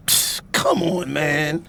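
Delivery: low, soft, slow aside; quiet urging. Acted. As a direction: murmur it, done with this.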